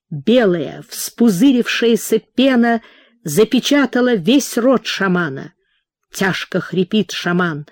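ordinary delivery

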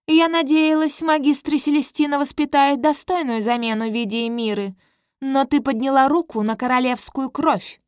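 put-on a queen scolding the girl; indignant queen. (надменная королева, высоким голосом)

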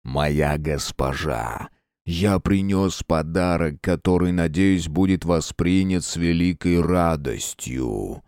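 low, slow, deep demonic voice; slow menacing villain rumble; dark lord. Acted. Dark lord speaks to queen.

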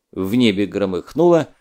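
ordinary delivery, nothing marked

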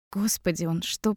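usual speaking voice

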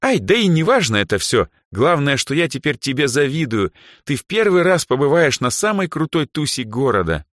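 high, loud, perky chatter; peppy. A narrator's reading. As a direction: perk up and gush brightly. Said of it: "опять бодро добавила".

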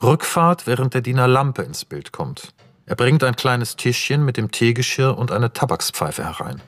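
ordinary delivery

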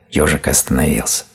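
plain reading voice

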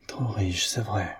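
"Trop riche" breathed in a voiceless whisper; whispering to himself in a soft, voiceless breath; murmured whisper. A narrator's reading. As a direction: let "Trop riche, c'est vrai" fall to a bare whisper. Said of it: "murmura-t-il".

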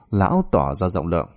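ordinary delivery, nothing marked

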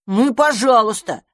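huffy. Acted. Offended mouse-enemy.

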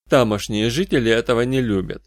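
ordinary delivery, nothing marked

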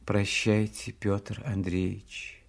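soft, low, slow goodbye; tearful heroine's hushed parting, understated; quiet farewell. A narrator's reading. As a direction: let the name fade softly and slowly. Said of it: "сказала она тихим голосом".

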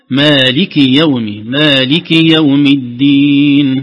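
don't lengthen the kasra of مالك; short kasra. Shown as a demonstration. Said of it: "طالت شوية منك". (كسرة قصيرة غير ممدودة)

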